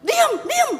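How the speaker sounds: high, loud mock yell; yelling at dog. Owner yelling at the dog, comic and loud. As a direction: shout at the dog to hush.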